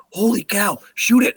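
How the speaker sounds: put-on high, loud, urgent kid; excited amazement. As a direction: imitate a boy bursting with excitement. Son amazed, urges the shot.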